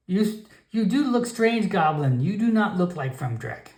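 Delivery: high, broken-English grandmother voice; stern old shaman warning off a stranger; old frog shaman. Acted. Grandmother shaman warns the Nightkin away, high and stern.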